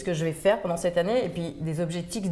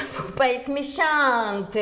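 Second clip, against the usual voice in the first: put-on lent, fort et aigu, accent du Midi; crié avec accent du Sud; 6 semitones higher, much slower than usual. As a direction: hurler avec l'accent du Sud, lentement. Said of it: "quand elle a hurlé". (la centenaire hurle son secret, accent du Sud)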